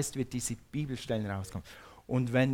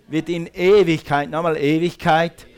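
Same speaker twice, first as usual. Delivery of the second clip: "Ewigkeit" hammered out much louder each time; stress on Ewigkeit; 4 semitones higher, 11 dB louder than usual. Real, not acted. Preacher drilling the word "Ewigkeit" into the crowd, loud and punched. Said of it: "sag mal Ewigkeit".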